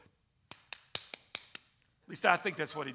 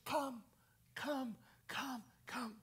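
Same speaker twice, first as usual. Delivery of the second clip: soft, higher-pitched beckoning; intimate divine voice; 7 semitones higher, 7 dB quieter, much slower than usual. Acted. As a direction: gently call someone closer, spacing each word. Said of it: "listen to the intimacy of his voice".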